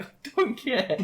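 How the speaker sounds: high-pitched, laughing protest; mock exasperation; exasperated dismissal. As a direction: cut them off, half laughing. Acted quarrel, dismissing the pitch.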